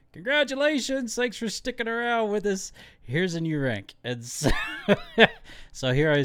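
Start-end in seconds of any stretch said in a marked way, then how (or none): 0.1-3.9 high, sing-song imitating the brass; mock official voice. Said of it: "it was one of those things of". 4.5-5.3 high, loud burst of laughter. Real, not acted. Laughs at own joke.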